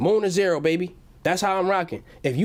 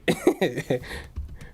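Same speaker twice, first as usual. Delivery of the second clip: laughing; 3 semitones lower, 4 dB quieter than usual. Real, not acted. Laughing at his own joke.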